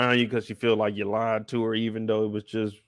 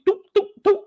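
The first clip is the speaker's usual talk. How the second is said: popping sounds; 5 dB louder than usual. Retold or imitated. Popping gummies.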